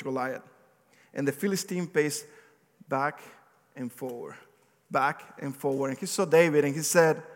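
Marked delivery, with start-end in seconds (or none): none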